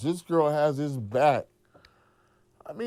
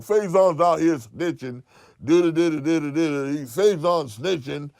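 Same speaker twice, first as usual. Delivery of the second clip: mocking accusation; 5 semitones higher, 5 dB louder than usual. Rival accusing him, mocking.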